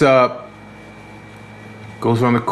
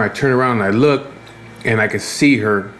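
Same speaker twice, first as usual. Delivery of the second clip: usual speaking voice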